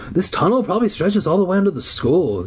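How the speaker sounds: usual speaking voice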